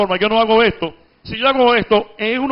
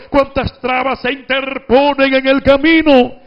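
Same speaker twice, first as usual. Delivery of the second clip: hyperbolic reading; 6 semitones higher, 5 dB louder than usual. Shown as a demonstration. Teacher showing students the booming political-speech reading, hyperbolic style. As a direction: thunder it out like a campaign speech. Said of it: "lo voy a leer entonces en forma hiperbólica".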